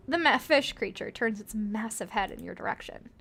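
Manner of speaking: ordinary talk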